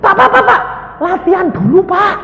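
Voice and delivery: high, loud, flustered warning; mock panicky scolding; bewildered nurse. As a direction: imitate a nurse urging a patient to stop. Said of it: "Susternya kan kebingungan".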